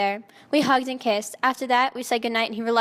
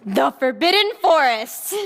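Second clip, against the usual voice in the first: slow, louder, weighty words; dramatic reveal; 2 semitones higher, 5 dB louder than usual. Acted. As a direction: land the place name like a reveal. Dramatic reveal in story reading.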